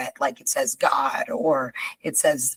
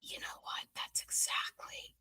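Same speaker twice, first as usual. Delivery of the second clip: very soft, voiceless whisper; accidental whisper; 19 dB quieter than usual. Real, not acted. Agreeing with her co-host, unwittingly whispering. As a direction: drop to a hushed whisper. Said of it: "why am I whispering".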